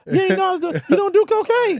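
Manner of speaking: squeaky sneering caricature of the drug itself; hammed-up mock voice; mocking cocaine addict voice. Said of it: "that people always say to me".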